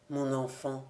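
low, soft fatherly address; gentle. A narrator's reading. Father soothing his angry invalid son, gentle. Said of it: "dit doucement le père".

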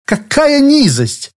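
high, slow, outraged cry. Acted. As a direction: spit out the disgust. Rhoda's outraged outburst.

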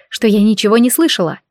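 ordinary delivery

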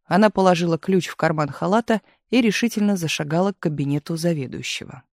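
plain reading voice

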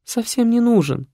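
high, plaintive woman's voice; weepy feminine protest; almost crying girl. A narrator's reading. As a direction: whine it, near tears. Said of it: "уже почти плакала девушка".

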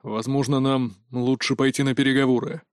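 low-key, glum suggestion; gloomy mutter. A narrator's reading. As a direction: mutter reluctant advice, slightly subdued. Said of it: "хмуро пробормотал Богдан".

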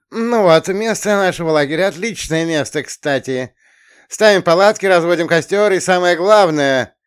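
booming pompous counselor voice; loud camp counselor. Acted. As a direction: proclaim it to the whole troop. Counselor addresses campers loudly.

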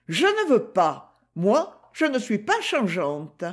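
lightly voiced young Gigi, protesting; Gigi, young girl. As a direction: play the girl, defiant and earnest.

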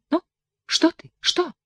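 put-on high, coaxing girl's question; girl asking. A narrator's reading. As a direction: tease him gently. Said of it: "спрашивала Семина". (вкрадчивый девичий вопрос)

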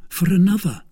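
"another" said high and loud, as a statement; high, emphatic "for another"; soubrette's firm answer. A narrator's reading. Kitty answers his question.

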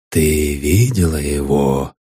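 deep, thundering voice from above; booming voice. A narrator's reading. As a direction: boom it out low like thunder. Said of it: "прогремел голос".

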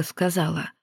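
ordinary delivery

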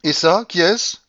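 loud, high, scornful babouchka voice; mock them all like a triumphant old matriarch; imperious old grandmother. A narrator's reading. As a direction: grandmother scoffing at heirs waiting for her death. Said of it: "demanda-t-elle en désignant".

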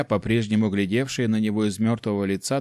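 ordinary delivery, nothing marked

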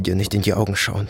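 usual speaking voice

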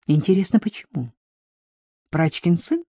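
plain reading voice

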